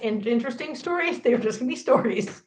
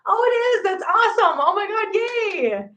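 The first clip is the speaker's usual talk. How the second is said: bright delighted squeal of excitement, pitched way up; excited; 10 semitones higher than usual. Real, not acted. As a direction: gush with real delight at the news. Excited reply to viewer's comment.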